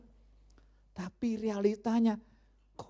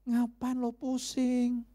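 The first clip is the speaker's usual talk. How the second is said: the pastor's inner voice telling him to let it go, higher; 6 semitones higher than usual. Retold or imitated. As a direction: let the voice inside needle you to let it go. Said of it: "ada suara ngomong".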